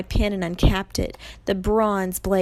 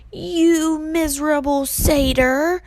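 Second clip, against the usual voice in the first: high, loud, slow snarl; drawn-out roar of rage; enraged snarl; 9 semitones higher, 5 dB louder, slower than usual. A narrator's reading. Medusa rages at Grover, snarling loudly. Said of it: "she snarled".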